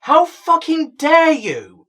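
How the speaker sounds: loud and drawn out, every word hammered. Pretend. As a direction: spit out "How fucking dare you" slowly, leaning hard on "How". Outraged at Disney casting choice.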